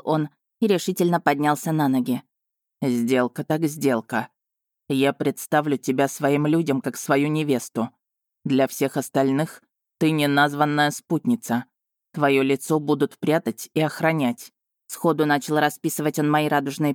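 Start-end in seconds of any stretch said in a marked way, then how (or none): 2.8-14.5 lightly voiced dragon. A narrator's reading. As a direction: play the dragon steady and sure.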